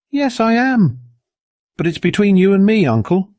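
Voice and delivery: put-on high, insistent child's voice; high, loud-edged plea from a small boy; earnest boy. A narrator's reading. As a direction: pitch it way up, pressing the point. Earnest boy insists to his uncle.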